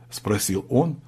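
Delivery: ordinary delivery, nothing marked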